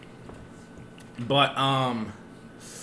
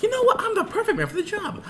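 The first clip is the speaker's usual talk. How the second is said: mock cocky self-quote; faster than usual. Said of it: "I was like".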